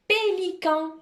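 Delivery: Pélican high, syllables linked without pauses; joined-up dictation. Shown as a demonstration. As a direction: say Pélican as one carefully sounded word. Same dictated word said joined.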